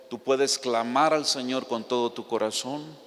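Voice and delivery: ordinary talk, nothing marked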